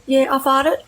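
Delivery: put-on high childlike confession, cheeky; owning it like a little kid; like a three-year-old. To the lady at the tailgate, a high cheeky childlike admission. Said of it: "I looked at her like a three-year-old and said".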